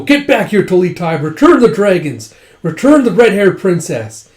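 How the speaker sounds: loud, high demands; retold shouting of pursuers; acted pursuit shouts. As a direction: yell after someone getting away. Pursuers shout after fleeing enemy.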